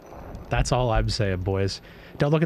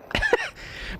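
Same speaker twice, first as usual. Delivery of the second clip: high giggle; 4 dB quieter than usual. Real, not acted. Laughs at worse reaction time.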